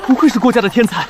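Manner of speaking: high, loud crowd cheer; excited shout of praise; admiring exclamation. Acted. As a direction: cry out in awe from the audience. Crowd marvels at perfect results.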